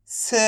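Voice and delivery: स sounded alone with a neutral release; half-pronounced letter. Shown as a demonstration. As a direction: give स without a full "aa". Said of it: "last वाले अक्षर का उच्चारण आधा किया जाता है". (स का आधा उच्चारण)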